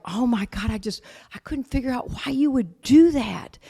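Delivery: imitated upset, rising high; mock-tearful disbelief; retold tearful outburst. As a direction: voice the hurt bewilderment, climbing in pitch. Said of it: "she burst into tears".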